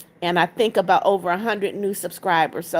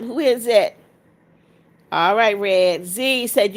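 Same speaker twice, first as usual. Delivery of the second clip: ordinary delivery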